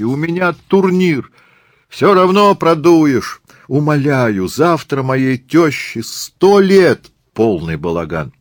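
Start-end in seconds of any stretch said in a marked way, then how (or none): 0.0-1.3 high, urgent plea; protesting cry. Acted. Tourist pleads a tournament, crying out. 1.9-3.4 high, loud, slow taunt; jeering heckle. Acted. Tourist taunts the tournament player, jeering. 3.7-7.1 high, loud, drawn-out plea; begging cry. Acted. Tourist begs for helicopter seat, pleading loudly.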